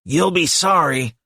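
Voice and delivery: put-on slow, high childish imp taunt; a sulky little demon's threat. A narrator's reading. Departing imp threatening Hellboy, slow and high.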